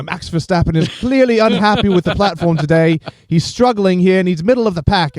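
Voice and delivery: high, excited race-commentator call. Retold or imitated. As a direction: mimic a TV F1 commentator. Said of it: "you're hearing them go".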